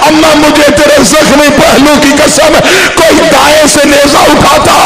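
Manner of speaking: high, loud wailing recitation, slowing at the end; wailing lament. Acted. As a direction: cry it out to the congregation at full force. Climax of the lament, the daughter's plea. (ऊँची आवाज़ में विलाप करते हुए)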